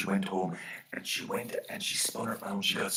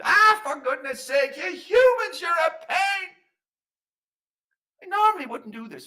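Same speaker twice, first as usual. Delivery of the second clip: high, loud, exasperated shout; exasperated fairy man; 13 dB louder than usual. Acted. Fairy man gives in to the pestering human, shouting in exasperation. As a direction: burst out in fed-up fairy tones.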